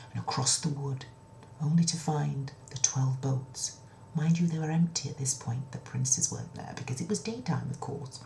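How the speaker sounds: usual speaking voice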